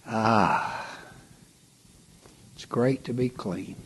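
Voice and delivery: ordinary talk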